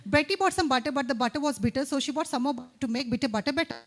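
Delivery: speeding up into a rushed finish; fast demo. Shown as a demonstration. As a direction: show them how fast it should go. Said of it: "it should be fast".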